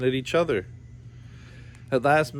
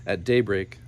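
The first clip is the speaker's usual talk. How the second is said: usual speaking voice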